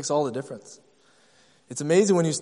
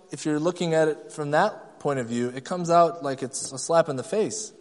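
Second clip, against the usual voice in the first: ordinary delivery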